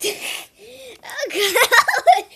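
loud helpless laughter; laughing fit. Real, not acted. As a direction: crack up, can't stop laughing. Laughing fit at intro start.

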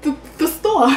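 flustered stammer on "The, the store"; stuttering. Retold or imitated. Lying to her dad about the store, stammering. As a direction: trip over the first word. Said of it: "when you start stuttering".

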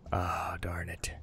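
slow, frustrated whisper; whispered curse. Real, not acted. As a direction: breathe the curse out in defeat. Quiet frustration at a failure.

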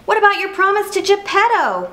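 high-voiced reminder, calling after him; high, insistent storybook cricket; Jiminy Cricket. A narrator's reading. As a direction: pipe up as the worried little conscience. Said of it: "called Jiminy Cricket".

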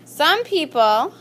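word by word, drawn out with long pauses; slow dictation. Shown as a demonstration. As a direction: dictate slowly for students writing it down. Dictates a sentence to the class.

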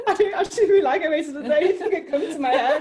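giggling, embarrassed; laughing through words. Real, not acted. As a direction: laugh while correcting yourself. Embarrassed at own answer.

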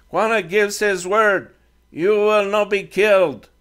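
put-on a defiant teen speaking halting English; Comanche boy leader.

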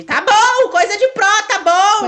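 loud, high sarcastic mimicry; high, loud taunting parrot; mocking echo. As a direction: throw her words back at her mockingly. Mocking sister's boast.

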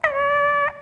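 high screeching tyre noise. Shown as a demonstration. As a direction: squeal like tyres on tarmac. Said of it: "Shall we all do it?".

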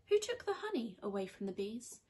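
usual speaking voice

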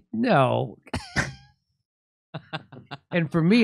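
usual speaking voice

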